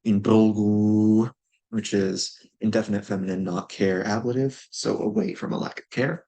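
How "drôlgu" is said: drôlgu said very slowly, syllable by syllable; careful pronunciation. Shown as a demonstration. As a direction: spell out drôlgu with sound. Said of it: "pronounced drôle".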